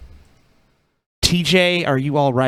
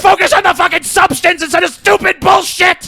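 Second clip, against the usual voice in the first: high, forceful outburst at nagging commenters; angry rant; 8 semitones higher, 3 dB louder than usual. Real, not acted. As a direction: snap into a furious raised-voice tirade. Ranting at his commenters.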